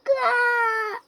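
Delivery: high, loud terrified scream; screaming in fear. Pretend. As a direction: scream out in fright. Scream on finding the talisman.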